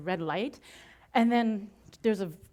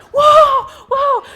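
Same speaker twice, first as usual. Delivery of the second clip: very high and much louder; excited whoa; 18 dB louder than usual. Retold or imitated. Backstage after selling out, thrilled. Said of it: "you hear my voice in the background going".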